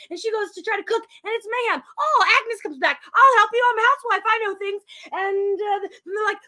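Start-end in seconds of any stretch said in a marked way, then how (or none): 2.0-4.8 high-pitched, eager housewife act; helpful neighbor. Retold or imitated. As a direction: play the chirpy helper, pitched up. Acting Agnes offering help.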